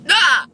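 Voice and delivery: high squeaky hiccup; real hiccup yelp; loud hiccup. Real, not acted. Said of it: "Here, I'll show you".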